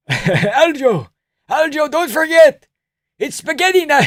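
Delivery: put-on high loud accented insistence; eager high Georgian voice; Merab impression. Merab voiced random advice.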